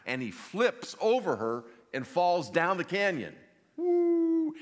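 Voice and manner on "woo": high, loud hooting wail; falling scream. Acted. Preacher acting Eustace falling down the canyon, screaming. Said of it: "Screaming the whole way".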